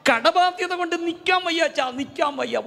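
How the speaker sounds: high, wailing mock sob of a debtor; a desperate man weeping before the priest, in a mock crying wail; retold crying plea. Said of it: "കരഞ്ഞുകൊണ്ട് പറയുക".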